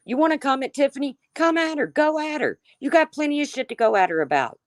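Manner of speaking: heated challenge. Real, not acted. Streamer daring the attackers to come at Tiffany, heated taunt. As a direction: goad them to come at her, fast.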